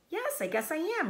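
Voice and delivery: high, innocent piglet voice; young pig voice. Acted. Wilbur answering the sheep, high and innocent. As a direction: answer like a naive little pig.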